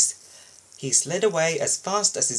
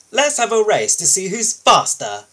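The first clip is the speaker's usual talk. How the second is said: boastful challenge; 7 semitones higher, 8 dB louder than usual. Acted.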